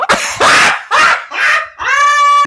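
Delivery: high screaming laughter; shrieking laugh. Real, not acted. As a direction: burst out in a shrieking cackle. Mocking Jamaica mistake.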